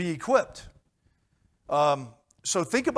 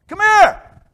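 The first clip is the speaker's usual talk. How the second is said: high loud call to come over; 16 dB louder than usual. Retold or imitated. Preacher recalling the women calling him over, a loud high shout. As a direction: shout it down the block.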